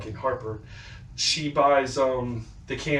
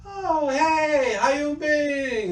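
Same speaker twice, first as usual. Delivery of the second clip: much higher, slow, cartoonish greeting voice; mock Gus greeting; much slower than usual. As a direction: put on a squeaky, drawn-out friendly hello as Gus. Imitating Gus greeting him.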